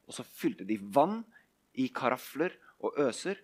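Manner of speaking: usual speaking voice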